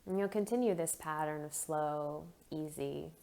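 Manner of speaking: soft, unhurried meditation voice with long pauses; guided meditation. Acted. Leads a guided breathing meditation.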